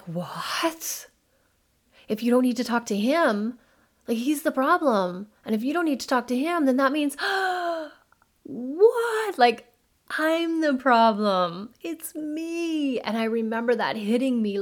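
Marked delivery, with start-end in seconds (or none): 0.0-7.1 indignant disbelief. Retold or imitated. Acting out her own indignant reaction. 7.2-7.9 big high gasp of realization; sudden stunned intake of breath; sharp shocked gasp. Retold or imitated. The realization hits her in the retelling. 8.4-13.0 much higher and louder, stunned; shocked at herself, high and loud; stunned realization. Retold or imitated. Acting out realizing she's the problem.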